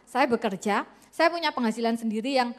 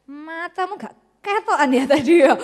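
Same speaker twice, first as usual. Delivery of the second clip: coarse Javanese jab, louder; rude husband; 3 semitones higher, 5 dB louder than usual. Acted. Husband speaking rudely to his wife after marriage. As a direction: snap at her carelessly.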